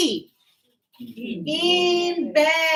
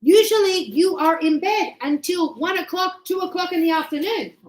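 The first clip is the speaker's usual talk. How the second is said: higher, punched-out mock scolding; scolding daughter; 4 semitones higher, 4 dB louder than usual. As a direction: imitate a daughter telling Mom off, high and emphatic. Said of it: "she says".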